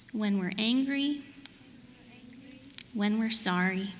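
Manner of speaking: measured prayer in short phrases; simple repeat-after-me prayer with long pauses; leading prayer. Real, not acted. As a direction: pray line by line, leaving room to repeat. Leads children in repeat-after-me prayer.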